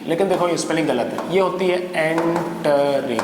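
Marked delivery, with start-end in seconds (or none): 1.9-3.2 एंटरिंग drawn out slowly in separate syllables. Shown as a demonstration. Teacher correcting the spelling for students, एंटरिंग slowly syllable by syllable. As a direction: sound out एंटरिंग slowly, piece by piece.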